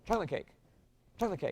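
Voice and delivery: quick "chocolate cake" punched on "choc", falling on "cake"; stress on CHOCOLATE. Shown as a demonstration. Director giving the wanted accent, stress on "chocolate". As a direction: hit "chocolate" and let "cake" fall. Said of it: "We want".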